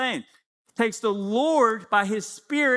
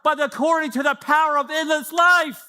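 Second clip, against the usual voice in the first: proclaimed out loud; 5 semitones higher, 4 dB louder than usual. Real, not acted. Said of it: "I love to just shout this out loud".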